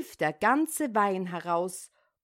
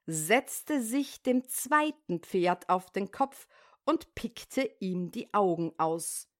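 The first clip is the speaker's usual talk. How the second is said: ordinary delivery, nothing marked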